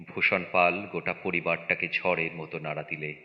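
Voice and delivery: ordinary delivery, nothing marked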